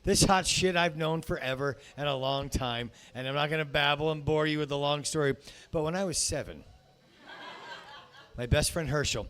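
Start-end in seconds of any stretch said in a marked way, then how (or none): none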